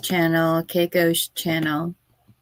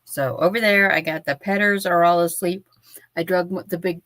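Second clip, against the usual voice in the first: usual speaking voice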